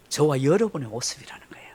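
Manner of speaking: breathy hushed reveal; whisper. Real, not acted. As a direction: lean in and whisper the point. Hushed revelation after pause. (속삭이듯)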